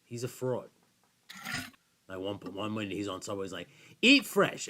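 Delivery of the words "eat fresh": high, loud commercial-slogan impression; mock ad pitch. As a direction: belt it out like a cheesy TV pitchman. Mimicking an athlete's Subway commercial.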